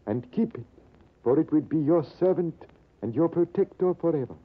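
put-on grateful old Persian. Old Persian voiced in story.

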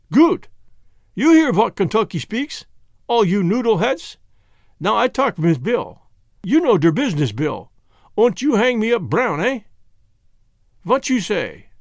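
put-on emphatic Germanic-accented bargainer; thick foreign accent, lively and insistent; Jan with Germanic accent. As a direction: lecture them in broken English. Jan addresses the men.

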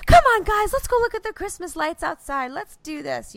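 high, loud peppy mom; rally the kids with forced enthusiasm; over-bright cheerleading, acted; sudden super-cheery switch. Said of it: "super bipolar mode was like".

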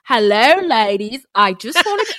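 put-on amateur Australian accent on "Hello, ladies". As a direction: try an Australian accent and falter. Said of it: "I'm trying to do an Australian accent".

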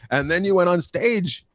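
ordinary delivery

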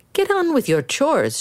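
put-on a mother ordering her boy about; scolding mother.

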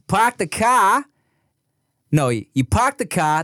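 Boston accent, r dropped in "park the car". Shown as a demonstration. Boston accent demo for a friend confused by accents. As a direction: drop the r's Boston style in "car". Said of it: "That's Boston".

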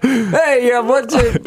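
bad attempt at an American accent; mock American accent, half laughing. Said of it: "I can't do an American accent".

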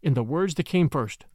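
ordinary delivery

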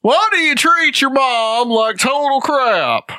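high, slow mock talk-show host; Doctor Phil. Host confronting girl about her mom, high slow impression. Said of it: "he talks like this".

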